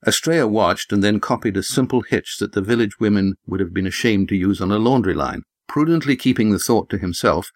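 plain reading voice

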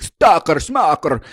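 high, loud mocking echo; mock neighbor retort. Neighbor sneering back at her, high loud mocking echo. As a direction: throw it back like a sneering parrot. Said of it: "And he was like".

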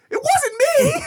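squeaky high denial through laughter; laughing denial. Retold or imitated. Retelling the daughter denying the fart, high and laughing. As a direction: insist while cracking up. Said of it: "And she goes".

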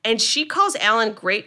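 ordinary talk

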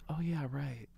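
whispering. Retold or imitated. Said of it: "She goes".

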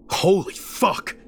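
high, slow gasped curse; startled gasp. A narrator's reading. Driver who nearly hit a woman, gasping. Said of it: "I gasped".

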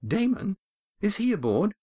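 sudden hopeful surprise; surprised. A narrator's reading. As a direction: catch your breath at the news. Estrella surprised at news.

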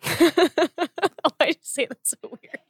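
squealing laugh, high, slow. Real, not acted. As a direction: laugh through the question.

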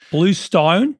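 imitated Aussie accent, drawn-out vowels; amateur Australian accent demo. Shown as a demonstration. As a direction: say it like an Australian, questioningly. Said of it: "say the restaurant again".

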